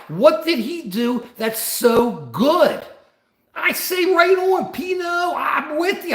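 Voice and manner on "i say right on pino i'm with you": high shouted call to a chatter. Real, not acted. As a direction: shout it out, pitched up. Calling out to a chatter.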